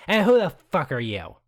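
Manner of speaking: high, loud hostile challenge; hostile demand. Acted. Angry woman confronting Phoebe, loud and high. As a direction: bark it at the stranger. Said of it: "she looks at you, Phoebe, and says".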